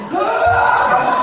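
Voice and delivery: sudden high yelp of terror; high startled scream; panicked scream. Retold or imitated. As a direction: yelp like the plane is falling. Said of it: "I was like".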